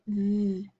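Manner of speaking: "v" said as the soft voiced th of feather; softly isolated "v" sound. Shown as a demonstration. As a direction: give only the sound "v".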